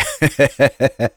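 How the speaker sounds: high, hearty burst of laughter; merry guffaw. A narrator's reading. Igor laughing at his own joke, merry guffaw. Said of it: "Игорь весело расхохотался".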